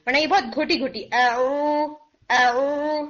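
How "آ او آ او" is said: the آ pulled inward into the throat, strangled; a deliberately wrong, throat-squeezed ain; choked ain demo. Shown as a demonstration. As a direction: swallow the sound back down the throat. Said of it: "یہ sound بہت گھٹی گھٹی سی ہوگی".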